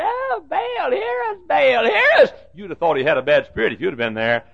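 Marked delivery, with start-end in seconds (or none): 0.0-2.3 high, whiny mock cry; mocking wail. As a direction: mimic the prophets to ridicule them. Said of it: "Elijah made fun. He mocked them".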